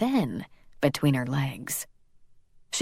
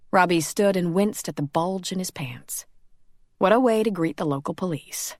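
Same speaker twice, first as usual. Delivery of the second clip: ordinary delivery, nothing marked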